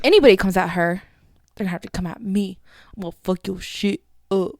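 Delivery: loud, low mock threat; unconvincing threat. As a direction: play tough loudly without really scaring anyone. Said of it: "That's, it's not scary".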